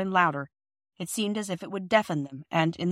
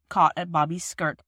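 ordinary delivery, nothing marked

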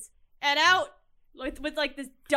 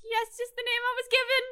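little elf voice; 9 semitones higher than usual. Acted.

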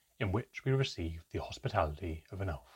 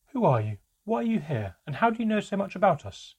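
raised, climbing pitch and louder voice; urgent demanding questions; 10 semitones higher, 6 dB louder than usual. A narrator's reading. Mother challenges the elf who knows about them, demanding. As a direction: fire demanding questions at a stranger.

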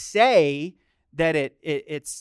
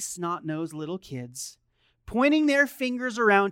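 ordinary talk

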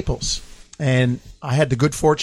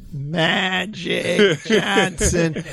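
slow, stretched New England announcer call; New England PA twang; 3 semitones higher, much slower than usual. Retold or imitated. Dan quoting the Boston PA call in a New England twang. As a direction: drag out the name like a Boston PA man. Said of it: "in the New England twang".

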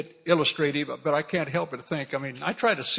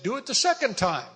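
higher-pitched order; commanding; 8 semitones higher than usual. Acted. Elijah ordering the people to pour water, commanding. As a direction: give the order over their heads.

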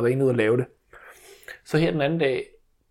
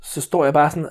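ordinary talk

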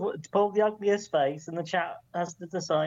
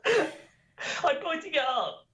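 laughing; 7 semitones higher, much slower than usual. Real, not acted. Laughing while exposed.

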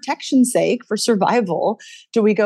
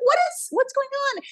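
high-pitched retold bafflement; puzzled question, voice pitched way up; baffled. Voice coach retelling her reaction to a student's lack of progress, baffled.

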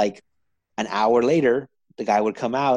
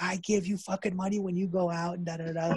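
mock angry mom; 7 semitones higher, 6 dB quieter than usual. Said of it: "she was so pissed".

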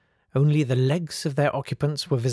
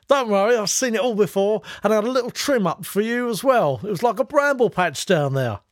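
high, loud put-on masseur voice, pitched well up; Anton Dubek voice; 9 semitones higher, 4 dB louder than usual. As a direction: chirp it out as the fussy masseur Dubek. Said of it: "I've never tried to do Anton Dubek before".

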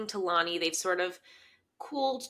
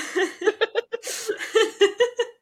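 laughing; 12 semitones higher, 5 dB louder than usual. Real, not acted.

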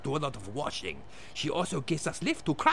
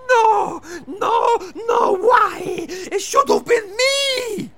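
sobbing No No No, screaming; anguished wailing shout; 10 dB louder, much slower than usual. Acted. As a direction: wail out loud in grief. Grief at friend's death.

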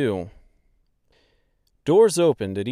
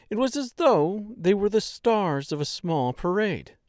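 plain reading voice

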